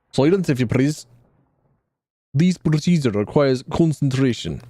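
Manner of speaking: put-on lightly done Russian accent for a fussy scholar; a hint of Russian accent, prim and precise. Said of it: "giving him the Russian accent".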